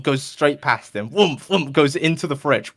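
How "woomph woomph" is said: loud booming woomph sounds; mock coffin thuds; coffin thud noise. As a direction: thump it out like a heavy coffin hopping.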